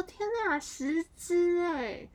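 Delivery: high, loud, slow stunned counting; shocked disbelief. Retold or imitated. Stunned by the ghost count, slow high and loud. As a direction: stretch each word in disbelief. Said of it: "我想说".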